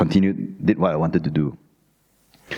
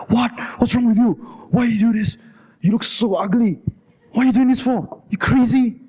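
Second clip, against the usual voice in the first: mum shouting; 13 semitones higher than usual. Retold or imitated. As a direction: shriek at your son like an outraged mother. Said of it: "She shouted".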